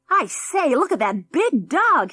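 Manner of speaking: put-on high, loud bratty kid; bratty boy calling out excitedly; excited spoilt boy. A narrator's reading. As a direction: squeal it out at the dog. Excited boy spots the dog.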